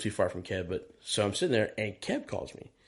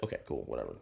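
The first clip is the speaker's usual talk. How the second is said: resigned mumble; 11 semitones lower, 10 dB quieter, faster than usual. Retold or imitated. Deflated reply in retold scene.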